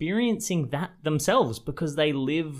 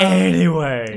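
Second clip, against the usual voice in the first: loud; 3 semitones higher, 9 dB louder than usual. Real, not acted. Moving on after a joke.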